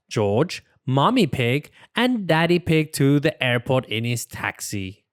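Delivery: ordinary delivery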